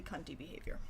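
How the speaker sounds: a fast breathy whisper of judgement; whispered verdict. Real, not acted. Streamer reacting to chat, whispered. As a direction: hiss it under your breath like a catty aside.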